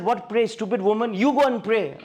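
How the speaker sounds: high, loud angry scolding. Retold or imitated. A husband scolding his praying wife, high and loud. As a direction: snap at her to go pray. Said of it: "I scolded my wife".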